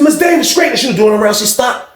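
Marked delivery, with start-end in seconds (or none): 0.0-0.7 higher called-out count; retold guard call.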